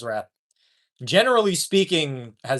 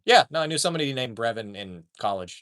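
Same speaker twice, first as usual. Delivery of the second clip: ordinary talk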